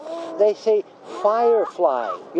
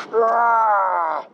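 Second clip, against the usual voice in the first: dog roar. Retold or imitated. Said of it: "They go".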